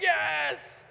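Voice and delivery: high triumphant yes; excited. Retold or imitated. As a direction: cheer it out loud. Celebrating outside Costco.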